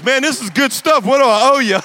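loud, high eager offer to pay; excited imitated gratitude. New convert grabbing his wallet, loud and excited. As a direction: gush as the delighted convert.

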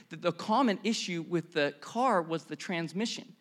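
ordinary talk

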